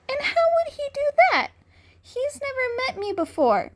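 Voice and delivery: put-on high, fast boyish voice; boy's quick question. A narrator's reading. Cat-eared boy challenges the bully, high and fast. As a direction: rattle it off in a high young voice. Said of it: "the boy said".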